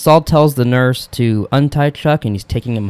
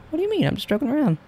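mock innocent high voice; 7 dB quieter, faster than usual. Gamer denying being rude.

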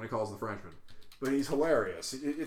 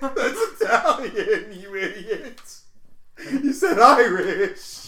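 higher, louder, slow through laughter; laughing correction; 8 semitones higher, 11 dB louder, much slower than usual. Real, not acted. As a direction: scold him while cracking up. Laughing correction of friend's mistake.

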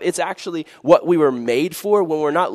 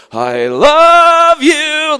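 very high, drawn-out, loud love declaration; dramatic confession; 11 dB louder, much slower than usual. Pretend. Crush in the living room declares love, very slow and high. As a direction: stretch it like a soap opera.

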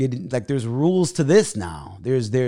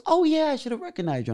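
very high-pitched phony recognition; fake Hollywood gushing. Lady at karaoke pretending to know him, high and fake. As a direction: imitate an insincere showbiz greeting, voice way up. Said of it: "So fake, so Hollywood".

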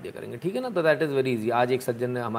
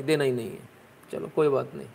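ordinary talk

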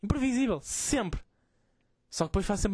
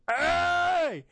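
high, stretched mock shout; high-pitched angry holler, not gruff; angry friend's voice; 10 semitones higher, much slower than usual. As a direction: imitate a furious friend yelling, drawing out each word. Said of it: "Só que não é a voz grossa, era tipo".

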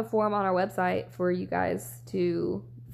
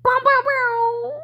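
festive noisemaker; 8 dB louder, slower than usual. Acted. New year celebration noise.

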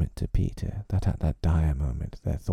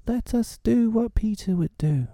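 high, bold rallying call; rally everyone together; rallying boys. A narrator's reading. The lost boys rallying against the wolves.

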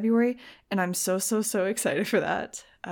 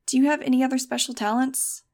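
raised, higher voice calling out a question; reporter's yell; 5 semitones higher, 6 dB louder than usual. Acted. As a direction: call it out like a reporter in a crowd. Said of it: "a reporter yelled".

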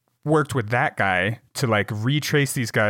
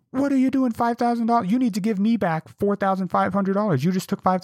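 much higher, put-on scammer voice, pushy; mock angry scammer; 10 semitones higher than usual. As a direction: squeal it like the scammer demanding money back. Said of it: "And then they go".

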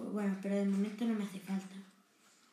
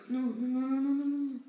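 panicked; 3 semitones higher, 5 dB louder than usual. Real, not acted. Danger while playing.

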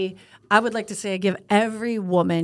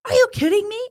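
mock outraged; 12 semitones higher, 4 dB louder than usual. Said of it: "he's like".

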